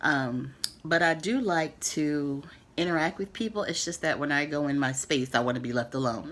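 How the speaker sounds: ordinary delivery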